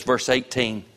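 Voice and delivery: ordinary talk, nothing marked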